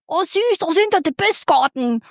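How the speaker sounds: put-on high-pitched Saxon accent, sweetly cooing; Saxon guard. Huge Saxon guard coos at the backpack in a high Saxon accent. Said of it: "so hat er geredet".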